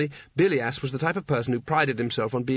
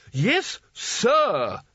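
much higher, sharp reprimand; snapping correction; 9 semitones higher, 3 dB louder than usual. Acted. Spelter correcting the boy's manners, snapping. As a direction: bite off the correction. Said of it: "snapped Spelter".

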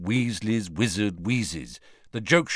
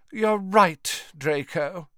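lightly voiced haughty mother, higher pitch; contemptuous; 8 semitones higher, slower than usual. A narrator's reading. Mother agreeing with her son, mildly contemptuous. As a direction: agree with a touch of disdain. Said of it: "with a contemptuous glance at Hermione".

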